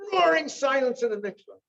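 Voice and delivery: loud, high burst on roaring; emphatic. Real, not acted. Storyteller describing a stunned bathhouse, emphatic and loud. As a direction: punch it out high and loud, then fall.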